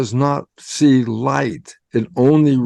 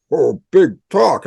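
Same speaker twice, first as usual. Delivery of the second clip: skeptical "whoa", pitched up and louder; mock scoffing listener; 5 semitones higher, 5 dB louder than usual. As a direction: scoff at the big claim like a doubter. Listener mocks the speaker's claim.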